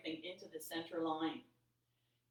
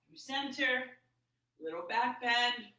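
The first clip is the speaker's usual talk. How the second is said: ordinary talk, nothing marked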